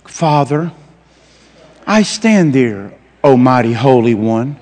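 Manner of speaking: mock-solemn, slow formal praying; low, drawn-out holy-man parody; pompous prayer voice. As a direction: intone it like a stiffly reverent churchman. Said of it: "I know some people, you know".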